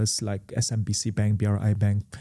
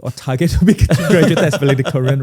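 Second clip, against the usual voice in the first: laughing through the words, a bit louder; 3 semitones higher, 8 dB louder than usual. Real, not acted. Founder to podcast host about his degree, laughing. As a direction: chuckle it out, amused at yourself.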